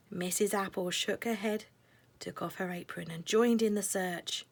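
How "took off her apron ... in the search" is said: ordinary delivery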